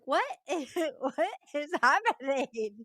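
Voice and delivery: high, loud mock bewilderment with a laugh; hammed-up, laughing disbelief; baffled reaction.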